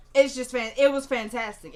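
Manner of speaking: shouting praise for the performance; real excitement. Real, not acted. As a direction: blurt out how great it was. Raving about the actress.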